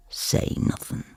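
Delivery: breathy whisper to herself; barely a breath. Acted. Narrator answering herself inside, whispered. Narrator's silent inner reply.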